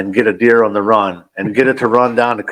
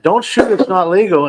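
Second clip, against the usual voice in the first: higher mock yell of his dad; imitating dad's yell; 9 semitones higher than usual. Son mimicking his dad calling a warning, higher mock yell. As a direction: play the cautious father shouting across the woods. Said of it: "that's definitely my dad".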